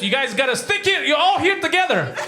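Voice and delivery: a lightly hammed-up bossy aid worker; mock UN official. UN staff herding couples together.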